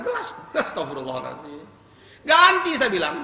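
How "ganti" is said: high, loud insistence; sharp angry demand. Retold or imitated. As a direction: insist he replaces the glass. Demanding again, higher. (menuntut dengan nada tinggi)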